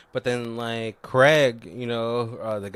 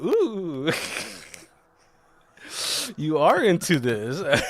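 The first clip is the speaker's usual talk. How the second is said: pleased surprise; 4 semitones higher, slower than usual. Retold or imitated. Acting out her pleased surprise.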